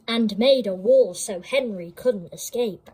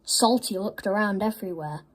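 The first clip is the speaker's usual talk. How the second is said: usual speaking voice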